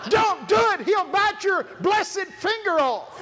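high, loud, slow warning shout; alarmed warning shout. Retold or imitated. Woman warning the preacher off the parrot, high loud shout. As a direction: shout to stop him, laughing. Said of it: "Sort of like that".

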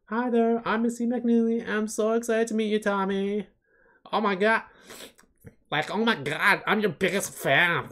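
high, bubbly host voice; Missy voice. Acted. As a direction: play the chirpy child star.

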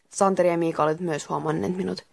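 plain reading voice

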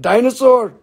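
ordinary delivery

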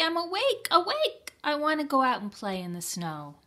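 put-on high, eager child's insistence; bouncing with eagerness to play; excited. A narrator's reading. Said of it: "cried Froggy".

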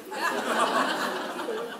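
a short, airy laugh at himself; breathy laugh. Real, not acted. Laughs at his own refusal.

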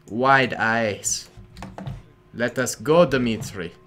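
slow, halting mock Russian accent; deliberate, hammy Russian accent; amateur Russian accent. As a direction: play a Soviet comrade with a clumsy Russian accent. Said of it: "I can't do accents".

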